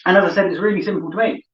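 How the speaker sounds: normal talking voice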